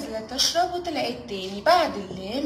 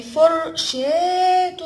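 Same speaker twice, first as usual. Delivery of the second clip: very slow, louder, each syllable drawn out; syllable-by-syllable stretch; 4 semitones higher, 7 dB louder, much slower than usual. Shown as a demonstration. Teacher to kids, sounding out a word, slow and stretched. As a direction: sound the word out slowly for small children. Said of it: "فر شا".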